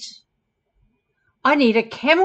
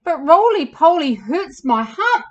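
higher, louder mock wail; storybook camel moaning about a hurt; wailed complaint; 6 semitones higher, 8 dB louder than usual. A narrator's reading. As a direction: wail it plaintively, pitched up. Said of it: "wailed Hannibal".

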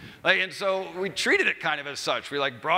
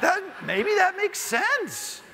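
drawn-out, much higher, puzzled musing; mock self-doubt; 3 dB louder, much slower than usual. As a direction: act like you're being talked into it. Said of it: "making me question".

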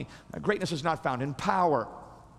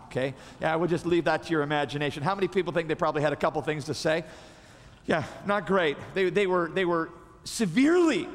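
ordinary delivery

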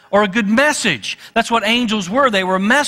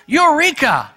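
excited discovery; 8 semitones higher, 3 dB louder than usual. Retold or imitated. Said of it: "is like".

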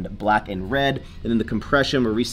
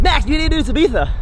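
quick higher put-on voice; mocking other people; 14 semitones higher, 8 dB louder, much faster than usual. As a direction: rattle it off in a mock voice. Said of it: "everyone's like".

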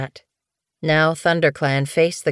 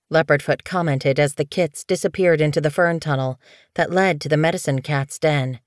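ordinary delivery

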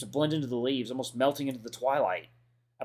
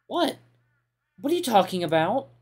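shocked; 9 semitones higher, 5 dB louder than usual. Acted. TJ voiced in shock.

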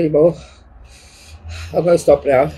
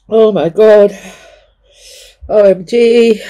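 pained exclamation; 4 semitones higher, 5 dB louder, slower than usual. Real, not acted. Chilli burn pain.

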